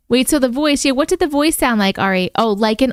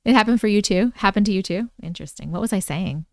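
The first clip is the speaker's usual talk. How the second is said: ordinary talk, nothing marked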